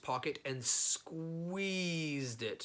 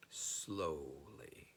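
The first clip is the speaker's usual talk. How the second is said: ordinary delivery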